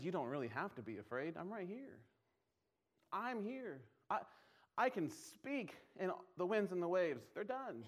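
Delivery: tender reassurance. Acted. Jesus voiced gently in sermon.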